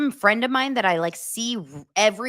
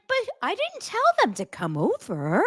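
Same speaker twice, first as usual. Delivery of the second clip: mock puzzlement; 8 semitones higher than usual. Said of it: "You wouldn't be like".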